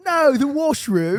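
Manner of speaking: high, loud British wail; slow, dismayed British protest; hammed-up British accent. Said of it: "we won't even speak like this".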